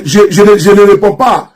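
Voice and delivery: normal talking voice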